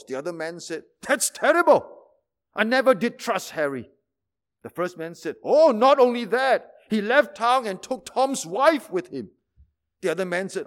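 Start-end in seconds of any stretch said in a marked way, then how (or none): 1.0-3.9 hammed-up gasp of shock, higher and louder; mock-horrified neighbour. Shocked gossip in joke. 5.4-9.3 louder, higher eager telling; gleeful gossip, hammed up. Gossip adds more news.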